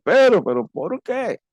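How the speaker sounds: mock bewildered protest. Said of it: "nosotros podríamos decir".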